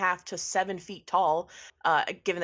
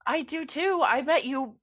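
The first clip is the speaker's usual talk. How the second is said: insistent; 9 semitones higher, 5 dB louder than usual. Acted. Tall woman insists, higher voice.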